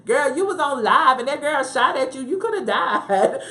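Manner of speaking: high, loud, disbelieving outburst breaking into laughter; incredulous mock scolding. Host scolding the woman who went live, incredulous.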